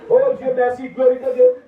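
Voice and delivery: merci et gloire lancés haut et fort; louange. Acted. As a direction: rends gloire avec éclat. Pastor praising God. (pasteur louant Dieu, voix haute et forte)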